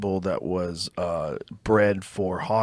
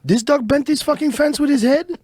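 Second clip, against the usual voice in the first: much higher mock voice of the welder; imitating the fence guy; 3 dB louder than usual. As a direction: play the shocked welder, pitched way up. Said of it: "He goes".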